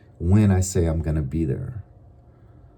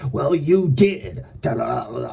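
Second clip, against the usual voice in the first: irate other person; 4 semitones higher than usual. Retold or imitated. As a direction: mimic the huffy accuser. Angry person retorts.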